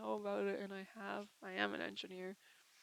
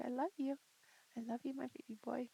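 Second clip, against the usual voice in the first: tender; 3 semitones higher, 3 dB quieter, faster than usual. Real, not acted. Soft words to her dog.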